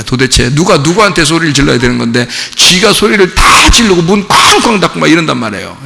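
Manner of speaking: ordinary delivery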